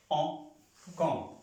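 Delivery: "O" as a nasal vowel, carried into "con". Shown as a demonstration. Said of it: "como la A con la N, suena". ("O" nasal, luego "con" igual)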